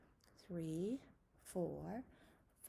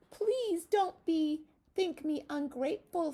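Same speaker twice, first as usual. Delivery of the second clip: bright storybook butterfly voice, higher and louder; 7 semitones higher, 10 dB louder than usual. Acted. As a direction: squeak it out big as a fluttery butterfly. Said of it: "said the butterfly to the lamb".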